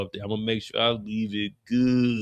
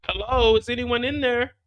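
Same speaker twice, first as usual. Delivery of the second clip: high calling; 10 semitones higher than usual. Acted. Rock calls again.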